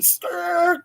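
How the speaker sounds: high vocal squeal of tires; imitated tire screech. Vans pull up outside the house, imitated screech. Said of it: "tires screeching from some vans".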